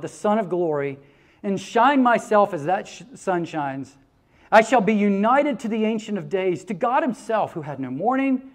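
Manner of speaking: put-on reading an old poet's words to the congregation, rising and solemn; poem recitation. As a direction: proclaim each line like a vow. Reads John Donne poem aloud.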